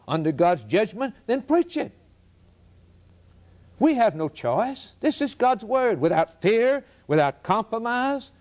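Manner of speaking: emphatic pulpit cadence with ringing rhetorical questions; impassioned preaching. Real, not acted. Preacher urging fellow preachers to preach it, fervent. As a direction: drive each point home to the congregation.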